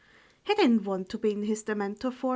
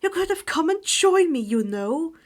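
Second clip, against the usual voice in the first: louder, higher reproachful line; glaring reproach; 6 semitones higher, 7 dB louder than usual. Acted. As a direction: scold her with a glare. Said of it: "he glared at her".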